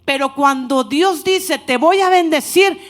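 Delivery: loud, slow, emphatic preaching; preaching at full force. Acted. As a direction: proclaim the promise to the church, loud. Preaching God's promise. (predicación enérgica y fuerte, proclamando la promesa)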